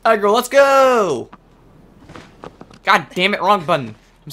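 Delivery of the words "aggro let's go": loud, high, drawn-out call. Real, not acted. Gamer calls his horse to ride, drawn-out shout. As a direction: holler it to get moving.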